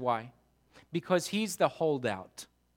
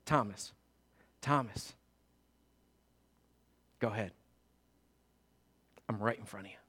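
lower, softer, gentle invitation; tender Jesus; 9 semitones lower, 8 dB quieter than usual. Pretend. Jesus inviting Thomas to touch him, tender. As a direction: speak gently to the doubter.

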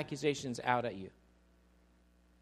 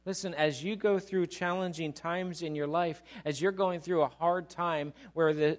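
ordinary talk

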